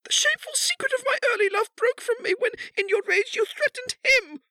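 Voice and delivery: very high, loud, tearful plea; anguished begging. A narrator's reading. Kneeling wife begs her husband, anguished and tearful. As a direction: beg desperately in a high crying voice.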